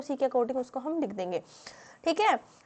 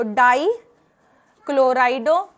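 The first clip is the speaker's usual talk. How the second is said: very slow and loud, syllables stretched; drawn-out classroom dictation with long held vowels; dictating; 8 dB louder, much slower than usual. Real, not acted. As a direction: spell out the term for note-takers. Dictating finished name while writing it.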